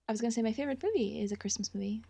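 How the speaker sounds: fast soft whisper. Real, not acted. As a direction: slip it in quietly, fast. Aside said softly.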